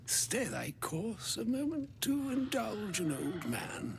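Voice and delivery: lightly voiced courtly old wizard; a touch of an elderly sage speaking formally. Game character voice, Elminster.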